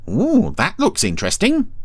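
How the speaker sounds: very high, swooping interest; curious Brian. Acted. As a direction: pipe up with keen curiosity.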